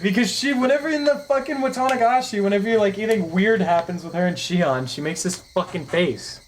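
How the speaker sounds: high, loud exasperated rant; worked up. Real, not acted. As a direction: vent the pet peeve heatedly. Worked-up complaint.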